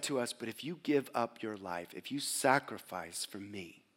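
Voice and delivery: softly voicing the Savior's promise; soft intimate quote. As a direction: drop to a hushed reverent voice. Quoting Jesus softly.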